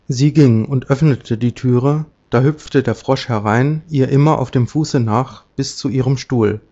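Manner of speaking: usual speaking voice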